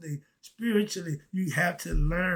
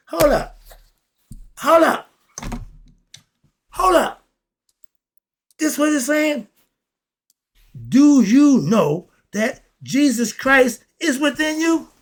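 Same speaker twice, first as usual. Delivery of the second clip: higher and louder with each hold up, then a slow, loud climax; building excitement; 9 semitones higher, 9 dB louder than usual. Real, not acted. Preacher stunned by his own revelation, rising excited shout. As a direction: work yourself up to a roar. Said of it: "I'm freaking out".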